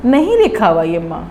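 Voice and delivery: usual speaking voice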